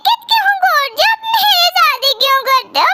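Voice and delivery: put-on high, anxious, pleading girl's voice; frightened student locked in, begging someone to open the door; scared girl trapped alone. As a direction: call out for help, afraid and near tears. Girl trapped in library, panicking.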